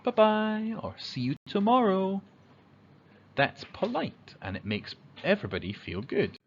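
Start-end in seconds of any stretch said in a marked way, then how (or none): 0.0-2.2 high, friendly sing-song farewell; cheery goodbye. Shown as a demonstration. Friend leaving school, high cheery goodbye. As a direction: wave a friend off brightly. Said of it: "you say".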